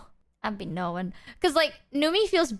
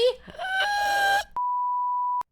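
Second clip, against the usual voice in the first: shocked gasp. Pretend. Mock shocked gasp before threat.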